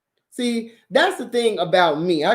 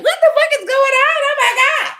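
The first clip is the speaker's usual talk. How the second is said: shrill, loud mock panic, much higher, laughing; panicked man; 5 dB louder than usual. Man thrown against the wall, mock-panicked high loud squeal. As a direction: squeal it like a man caught off guard. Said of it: "He'd be like".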